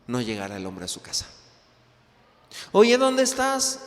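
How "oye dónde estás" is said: angry wife. Acted. Wife calling her late husband.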